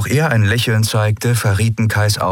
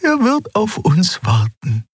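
much higher, light reassuring Xea voice; reassuring alien voice; 13 semitones higher, slower than usual. A narrator's reading. Xea reassuring Endriel about the admiral, high and calm. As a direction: comfort her in a high, gentle voice. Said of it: "sagte sie".

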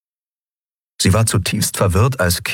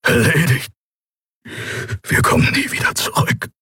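hushed, voiceless whisper; 8 semitones higher than usual. A narrator's reading. Keru seeing no way back, whispering. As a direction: whisper it as if all is lost. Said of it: "flüsterte Keru".

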